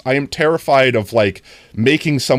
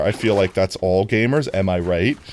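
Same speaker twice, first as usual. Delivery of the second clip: ordinary talk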